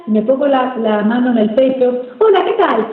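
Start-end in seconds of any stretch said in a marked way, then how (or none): 2.2-2.9 high, put-on hello with no chest resonance; artificial voice. Shown as a demonstration. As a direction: greet in a disconnected high pitch. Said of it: "realmente no me resuena nada". (saludo agudo, sin resonancia en el pecho)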